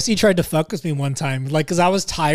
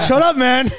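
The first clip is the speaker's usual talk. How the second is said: high, laughing shut up; laughing retort; 8 semitones higher, slower than usual. Retold or imitated. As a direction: laugh while telling them off. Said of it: "He's like".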